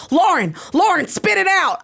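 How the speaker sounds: imitated urgent call, pitched high; high, insistent mock holler; calling out urgently. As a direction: call out to a teammate to spit it out. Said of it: "Elaine's like".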